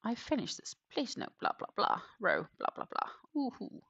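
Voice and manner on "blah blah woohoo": ordinary delivery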